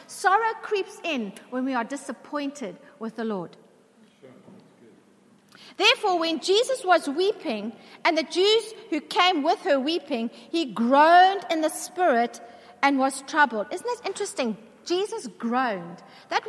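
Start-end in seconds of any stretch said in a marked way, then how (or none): none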